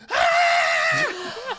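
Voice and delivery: retold scream of a startled moviegoer in a cinema. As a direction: shriek like someone jumped out of the dark at you. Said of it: "she just turns around and goes".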